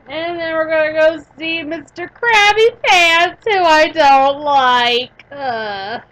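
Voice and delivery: loud, high, drawn-out grumble; mock distaste. Player naming a character she dislikes, mock distaste. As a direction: stretch it out with exaggerated dislike.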